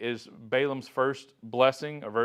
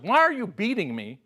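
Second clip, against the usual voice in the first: pleading donkey; 7 semitones higher, 4 dB louder than usual. Retold or imitated.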